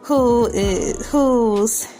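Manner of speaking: ordinary talk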